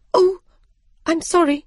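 high, startled self-interruption; high-pitched oops of regret; flustered apology. A narrator's reading. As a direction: break off and apologise, pitch jumping up. Alice catches her blunder, apologises.

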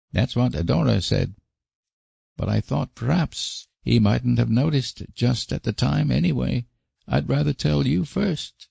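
put-on high-pitched child's voice, unburdening himself; high little-boy voice, confiding; Davy, upset little boy. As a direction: play a troubled small boy, pitched high. Boy explains himself to Anne.

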